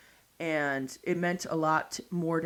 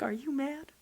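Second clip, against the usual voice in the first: timid worry; 9 semitones higher, 4 dB quieter than usual. Retold or imitated. Nervous question to father-in-law.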